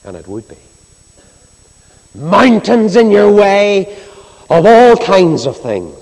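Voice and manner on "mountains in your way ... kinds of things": high, loud, slow preaching cry; loud proclaiming. Real, not acted. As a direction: call it out over the whole hall, slowly.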